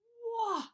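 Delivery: high, loud "wah"; storybook baby's high cry; yelled baby wail. A narrator's reading. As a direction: wail like a startled little one. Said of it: "yelled Finn".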